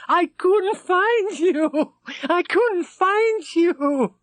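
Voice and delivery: very high, slow, drawn-out cry; screaming with relief. Acted. Rashid hugging the rescued men, screaming with relief. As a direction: wail it out in relief.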